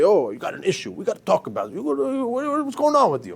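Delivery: pushy, pitch climbing high on the what's; hammed-up nosy friend barging in; mock forceful confrontation. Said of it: "Don't come forceful".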